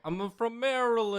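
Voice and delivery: high, exaggerated New York voice; New York accent. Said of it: "You can't stop doing the New York voice".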